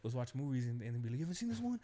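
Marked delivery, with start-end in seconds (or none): 1.2-1.8 higher, louder imitation of the buddy pushing a movie; eager friend imitation. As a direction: mimic him excitedly insisting you watch it now.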